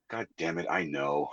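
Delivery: very low and soft, to himself; quiet frustrated muttering. Retold or imitated. Comic voicing his inner reply to advice, low muffled frustration. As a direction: drop it to a weary mutter. Acts out his own weary reaction.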